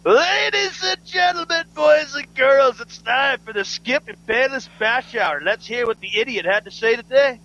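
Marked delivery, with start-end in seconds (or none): 0.1-5.4 slow, high showman announcer. Pretend. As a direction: introduce the show like a ringmaster. Announcing the segment.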